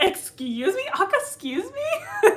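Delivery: sassy "excuse me", rising high on the second; mock offended. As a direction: play it indignant and sassy. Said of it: "Colton being like".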